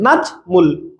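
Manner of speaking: নাজমুল broken slowly into নাজ and মুল; teacher sounding out নাজমুল, pausing between syllables; two-beat syllable split. Shown as a demonstration. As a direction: say নাজমুল in two separate beats. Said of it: "কয়বারে উচ্চারণ করছেন? দুইবারে।".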